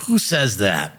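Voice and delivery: slow, laughing repeat of the question; amused, drawn-out laughing question. Real, not acted. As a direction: say it again, cracking up.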